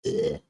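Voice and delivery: sharp high ugh; frustrated ugh. Real, not acted. Fixing eyeshadow, annoyed high ugh. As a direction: groan at the makeup.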